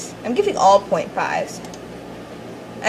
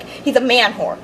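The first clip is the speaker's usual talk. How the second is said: ordinary talk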